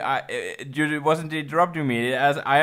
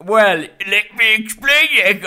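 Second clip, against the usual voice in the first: Daredevil voice; 4 semitones higher, 3 dB louder than usual. Pretend. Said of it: "Stop using that voice".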